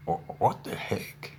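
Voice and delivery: half-whispered disbelief at the late call; a hint of shocked annoyance; startled huh. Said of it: "I was like".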